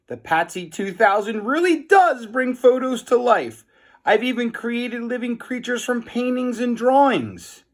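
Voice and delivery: high, pleading nerdy voice; high insistent Melvin. A narrator's reading. Melvin insists to the boys that it's real, in a high whiny voice.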